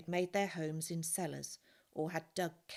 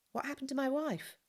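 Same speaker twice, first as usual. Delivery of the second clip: pitched high and urgent, a worried husband; anxious question; 9 semitones higher, 3 dB louder than usual. Acted. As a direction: ask it in sudden fear for her. Anxious husband asks about wife.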